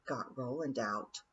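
low, soft mutter. A narrator's reading. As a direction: mumble it to the ground. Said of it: "she muttered".

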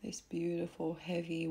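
ordinary talk

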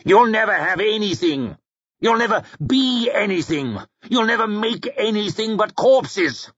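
high, insistent villain's tirade; scornful taunt. A narrator's reading. Bethod taunts Logan as he climbs.

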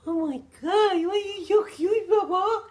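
high, loud cooing over a cute dog; gushing pet talk. Real, not acted. Owner gushing at her cute dog Baba, high and loud. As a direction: squeal it like you can't resist.

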